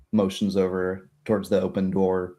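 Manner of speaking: ordinary talk, nothing marked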